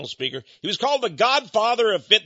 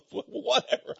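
usual speaking voice

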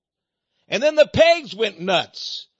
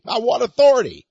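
louder, higher challenge; emphatic demand; 3 semitones higher, 6 dB louder than usual. Retold or imitated. IRS agent challenging the preacher's authority, emphatic demand. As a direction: repeat the question forcefully, imitating an official.

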